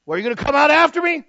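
loud, high, quick yell; hammed-up shouted question; acted opponent's challenge. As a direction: holler it at a backing-off rival.

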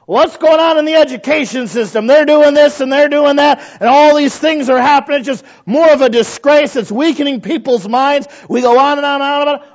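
high, loud, driving tirade; loud, high-pitched preacher in full flow; full-force preaching rant. Real, not acted. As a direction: pile up complaints at full voice. Mounting rant in the sermon.

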